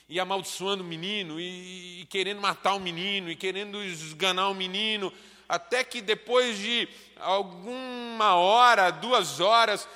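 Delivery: building from firm to loud, high preaching; preaching at full force. Acted. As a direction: preach it, rising to a cry. Narration swells into preaching. (pregação crescente, cada vez mais alta e aguda)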